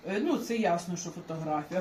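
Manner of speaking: normal talking voice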